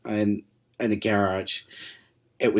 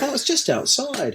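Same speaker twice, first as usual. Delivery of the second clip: casual high quote; 14 semitones higher than usual. Retold or imitated. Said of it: "He's like".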